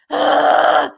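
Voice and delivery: loud, gusting breath out; huge sigh of disbelief; overwhelmed sigh. Real, not acted. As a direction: blow out a big, loud breath. Overwhelmed by large donations.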